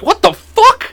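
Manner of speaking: squeaky high startled cry; high retold shock. Driver retelling the pine cone hit, high startled yelp. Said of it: "I'm like".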